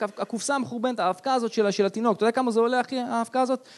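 usual speaking voice

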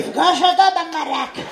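lightly voiced Yemenite grandmother. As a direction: answer like a curt grandma. Grandmother voice again.

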